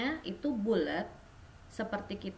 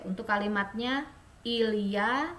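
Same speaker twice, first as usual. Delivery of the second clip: usual speaking voice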